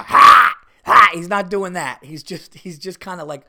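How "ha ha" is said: high, loud huffing "ha" bursts; mock heaving "ha, ha, ha"; dog's retching imitation. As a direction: imitate a dog gagging with sharp breathy huffs. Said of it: "he's not doing the".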